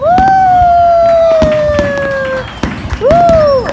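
very high, loud long whoops; celebratory whoop. Real, not acted. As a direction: scream a cheer for the winner. Cheers the winner.

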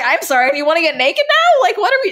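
high, incredulous disbelief. Retold or imitated. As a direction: sound taken aback and baffled. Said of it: "I was like".

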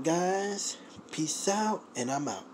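ordinary delivery

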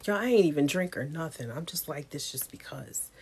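ordinary delivery

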